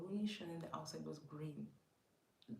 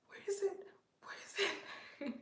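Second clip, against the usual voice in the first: panicked where is it; 13 semitones higher, 5 dB louder than usual. Retold or imitated. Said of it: "And then I'm like".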